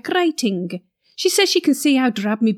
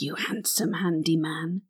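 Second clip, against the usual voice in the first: seductive whisper; 5 semitones lower, 9 dB quieter than usual. Acted. Flirting with Derek.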